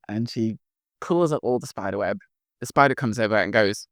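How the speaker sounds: ordinary talk, nothing marked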